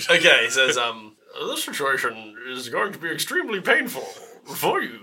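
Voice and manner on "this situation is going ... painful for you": muffled menacing Bane-style villain through a mask. Acted. Armored villain threatening the boy, a hint of Bane. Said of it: "He sounds like Bane".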